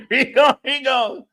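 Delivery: high, laughing voice; squeaky-high words through a laugh. Real, not acted. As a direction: crack up while saying it. Cracking up at own joke.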